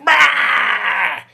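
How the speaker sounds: high, loud shouted bah; loud high boo to scare; bear jump scare. Acted. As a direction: leap out and roar it high. Bear jumps out of drawer.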